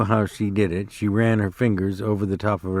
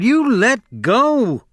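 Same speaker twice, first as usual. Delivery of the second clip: higher, louder, slow cry; 12 semitones higher, 5 dB louder, slower than usual. A narrator's reading. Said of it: "she cried".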